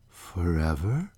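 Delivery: low, soft and slow, a grave question; solemn. Acted. Stranger gravely questioning her lover's vow, solemnly. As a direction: let the word hang, solemn and hushed. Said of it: "said the stranger solemnly".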